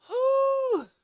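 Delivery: long, high-pitched exhaled whew. Real, not acted. Overcome by how sad it is, high whew.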